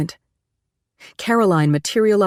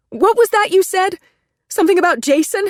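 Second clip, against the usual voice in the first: high, raised sharp demand; 4 dB louder than usual. Acted. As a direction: insist he explain. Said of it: "she demanded".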